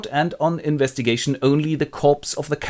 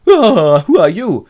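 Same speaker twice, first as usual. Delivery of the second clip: loud, high mock fright; yelp, then demand who's there; startled cry; 7 semitones higher, 10 dB louder, slower than usual. Harker startled by three ladies.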